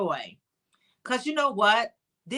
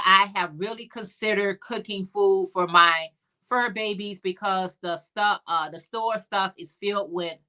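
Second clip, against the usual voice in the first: usual speaking voice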